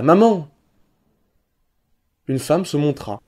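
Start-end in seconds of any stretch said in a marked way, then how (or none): 0.0-0.5 high, loud call for his mother; child calling out to his mother at the door, high and loud; child's cry. A narrator's reading. Boy calling his mother from the doorstep, loud high cry. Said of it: "Et il cria".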